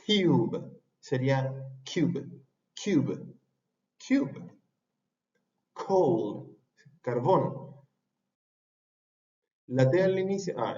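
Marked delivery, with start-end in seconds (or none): 0.0-4.6 cube slowed, with a big puff of air on the k; exaggerated aspiration. Shown as a demonstration. Teacher exaggerating the puff of air in cube. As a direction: overdo the breath on the k of cube. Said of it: "La estoy exagerando un poquito".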